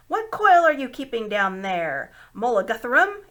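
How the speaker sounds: loud commanding call down the stairs; young man shouting. A narrator's reading. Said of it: "it shouted".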